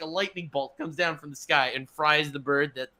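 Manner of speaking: normal talking voice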